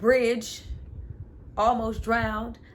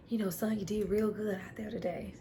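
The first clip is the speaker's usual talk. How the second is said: softer, hushed praise to son; tender father; 7 dB quieter than usual. Retold or imitated. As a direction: praise the boy gently. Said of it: "father bonding son moment".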